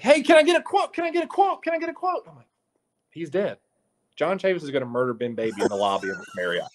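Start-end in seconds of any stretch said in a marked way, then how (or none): 0.0-2.2 much higher, sing-song eager pester; eager reporter voice. Retold or imitated. Reporter pestering the coach, squeaky high eager voice. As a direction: chirp it up high like an overeager kid reporter. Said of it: "He's like".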